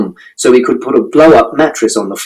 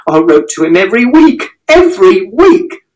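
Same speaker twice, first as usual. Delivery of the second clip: high, rising, slowing yell; desperate shout; 8 semitones higher than usual. A narrator's reading. Mother shouting her defence at father. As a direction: insist on it, anguished. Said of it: "And Mother shouted".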